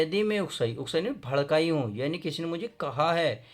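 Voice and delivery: normal talking voice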